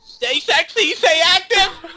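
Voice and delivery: high, squealed catchphrase, laughing; mock video sign-off. Mocking the friend's video ending, high squeal. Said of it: "what do you say when you finish videos".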